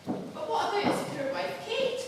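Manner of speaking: ordinary talk, nothing marked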